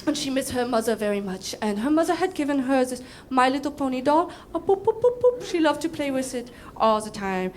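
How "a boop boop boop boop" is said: playful boops. Retold or imitated. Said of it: "pony sound imitated".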